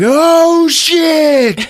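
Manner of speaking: loud, high alarmed yell; hammed-up shocked cry; mock panic. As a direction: shout it in sudden dismay. Brian shocked Blondie survives, retold.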